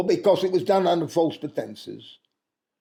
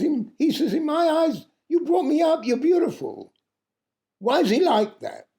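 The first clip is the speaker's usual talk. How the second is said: put-on much higher, a boy's pleading voice; young son asking; 11 semitones higher than usual. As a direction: ask like a puzzled child. Young son questions his mother.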